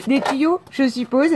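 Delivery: high, loud, laughing at your own slip; tickled by your own mispronunciation; amused self-mockery. Real, not acted.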